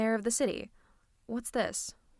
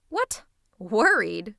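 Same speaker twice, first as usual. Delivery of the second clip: incredulous; 7 dB louder, much slower than usual. Acted. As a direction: scoff at the very idea, voice jumping up. Villain denies having been worried.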